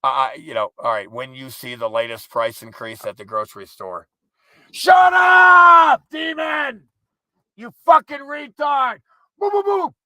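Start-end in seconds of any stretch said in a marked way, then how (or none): 4.7-6.8 high, loud scream at the bird; furious shout. Real, not acted. Man screaming at a noisy bird outside, furious high yell. 7.6-9.0 loud, high cursing shout; angry yell. Real, not acted. Man cursing the bird outside, high angry shout. 9.4-9.9 high, loud barking boos; barking like a dog. Real, not acted. Man barking at a bird to make it stop, loud high boos. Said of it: "You gotta sound like a dog".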